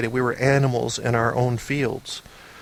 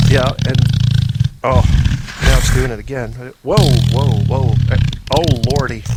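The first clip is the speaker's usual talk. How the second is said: mock stomach rumble; 7 dB louder than usual. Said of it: "it started communicating with me".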